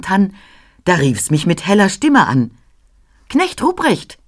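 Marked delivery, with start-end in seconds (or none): 3.3-4.2 put-on high, bright call of the Christ Child. Christ Child calling out to Knecht Ruprecht in a high voice. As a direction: call his name up high like a heavenly child. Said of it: "rief es".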